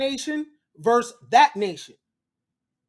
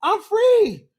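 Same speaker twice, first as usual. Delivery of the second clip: high confused John; 9 semitones higher, 4 dB louder than usual. Retold or imitated. John protesting to Anthony, high and bewildered. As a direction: play the freed man baffled, up high. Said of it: "John like".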